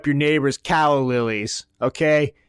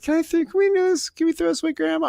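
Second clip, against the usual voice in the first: put-on much higher, sugary little-girl coaxing; granddaughter coaxing; much faster than usual. Granddaughter coaxing grandma to throw out junk, high childlike pleading. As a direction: sweet-talk grandma into letting go. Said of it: "She's like".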